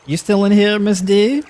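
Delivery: high, sing-out check for someone; calling out. Real, not acted. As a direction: call up high to see if they're there. Calls out to a listener.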